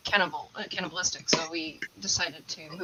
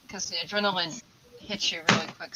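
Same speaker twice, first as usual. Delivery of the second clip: ordinary delivery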